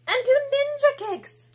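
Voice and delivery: high, bright-eyed boast about ninja kicks; excited kid voice. Acted. Girl proving kids are fun, high and excited. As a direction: pitch it up like an eager kid showing off.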